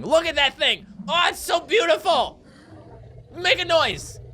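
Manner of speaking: high-pitched, thrilled outburst; really excited. Real, not acted. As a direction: gush in awe, then eagerly egg it on. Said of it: "I'm just really excited about this".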